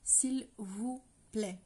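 "s'il vous plaît" said slowly and clearly in French. Shown as a demonstration. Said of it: "we use".

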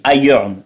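iron as EYE-ern with the O swallowed; English iron. Shown as a demonstration. Dad teaching the host how his son says iron. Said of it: "Ça se dit comment?".

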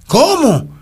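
sharp high squeak of disbelief, retold; astonished disbelief. Recalling the pastor's message of love, stunned high disbelief. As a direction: leap up in pitch as if you can't believe it.

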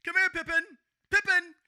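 high, loud imitated dog call; mock owner shouting across a beach. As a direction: call out for a lost dog, acted. Imitates man calling his dog.

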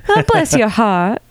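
hammed-up Southern belle; Southern accent. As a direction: drawl it like a Southern lady.

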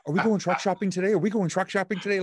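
put-on high, fast, eager kid pleading; excited five-year-old. Wife mimicking husband as an eager little kid, high and fast. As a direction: bounce like a child begging for a treat. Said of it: "You've created a five-year-old".

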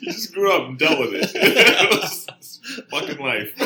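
cracking up while telling the podcast host about growing up; laughing. Real, not acted. Laughing at his own story to the host, slow and breaking up.

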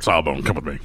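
low and terse, all business; low gruff command to his partner. Acted. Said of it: "Boxdrag is like".

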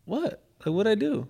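high-pitched retold "who, me?" protest; innocent protest. Boyfriend told to chill by his girlfriend, innocent protest.

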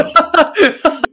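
risa alta y estridente; carcajada fuerte. Real, not acted. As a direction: explota de risa. Risa fuerte del amigo. (carcajada fuerte del amigo)

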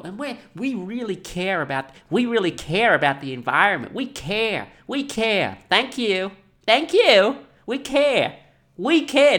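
high, loud mock sincerity; mock hotel voice. Mocking the hotel's "we care", high and loud. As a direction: ham up their fake caring.

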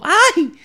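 high sharp yelped ay; retold yelp of pain. Retelling burning the hand, high yelp. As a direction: reenact the burn scream. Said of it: "grité de verdad".

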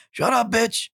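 put-on high, cranky old man snapping; grumpy old man. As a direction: bark like a crabby old geezer. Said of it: "I'm gonna be a grumpy old fuck".

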